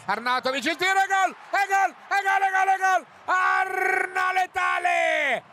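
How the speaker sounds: screaming "e gol" high and loud; goal scream. Real, not acted. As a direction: explode at the goal, repeating it. Goal scored, commentator screams gol. (urlo del gol)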